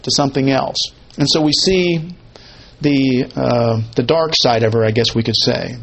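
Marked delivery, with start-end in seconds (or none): none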